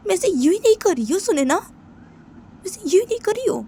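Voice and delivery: high, loud wounded outburst; upset outburst. Pretend. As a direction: cry out how hurt and angry you are. Upset character explains loudly, distressed.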